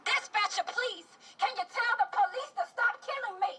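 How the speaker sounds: measured phrases building to high urgency; impassioned poetry performance; spoken-word protest poem. Acted. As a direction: recite a poem, rising into a desperate plea.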